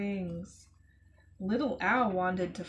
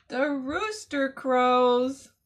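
excited gasp; 3 semitones higher, 8 dB louder, slower than usual. Acted. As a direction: gasp and say it loud and slow. Gasp before the rooster's call.

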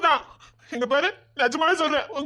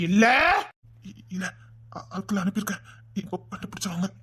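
soft, lower stuttering excuse; frightened stammer; 5 semitones lower, 4 dB quieter than usual. Acted. Nervous officer stammering excuses to the angry father. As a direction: stammer it out, scared of him.

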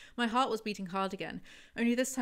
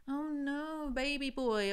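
put-on higher, cooing sarcastic baby talk; mock coo; 6 semitones higher, much slower than usual. Reviewer mocking Stark, sarcastic cooing. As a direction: coo at him like a spoiled child.

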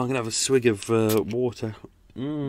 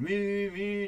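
higher, playful mimic of a droning pump; lightly voiced mechanical moo; pump noise imitation; 8 semitones higher, 5 dB quieter than usual. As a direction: mimic the pump's drone. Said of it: "the water pump has just been going".

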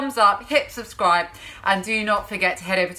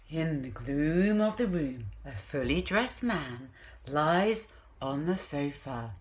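slow, low storybook reading; story narration; 4 semitones lower, 9 dB quieter, slower than usual. A narrator's reading. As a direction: set the gloomy scene softly, like a bedtime book. Reads story aloud.

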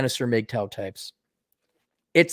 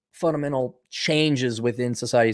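ordinary delivery

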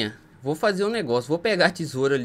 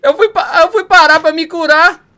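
agitated; 8 semitones higher, 11 dB louder than usual. Real, not acted. Under attack, explaining.

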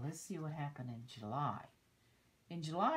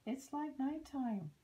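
high, louder storybook cry; cried out; 11 semitones higher, 5 dB louder than usual. Acted. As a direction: call it out in mock alarm. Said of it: "cried the sea urchin".

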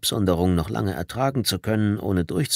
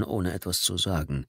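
plain reading voice

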